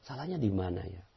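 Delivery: very soft murmur to oneself; hushed, repeated admission; humble introspection. Shown as a demonstration. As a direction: quietly search yourself for fault. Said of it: "Jadikan cermin ajaib".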